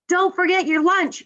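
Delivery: high mock yell from Mom. Mom calling to a child about lunch, mild yell. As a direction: call it out high, as a reminder. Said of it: "yelled Mom".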